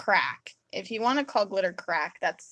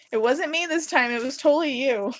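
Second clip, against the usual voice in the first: louder, higher gleeful self-defence; teasing blame; 5 semitones higher, 7 dB louder than usual. Retold or imitated. Wife to husband after his glitter spill, teasing blame. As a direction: pin it on them, high and loud.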